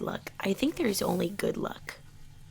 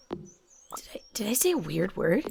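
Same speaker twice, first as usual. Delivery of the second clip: hushed self-check, voice dropped to a whisper. Real, not acted. Gamer whispering to viewers about a slip of the tongue, whisper. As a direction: whisper it as if catching yourself.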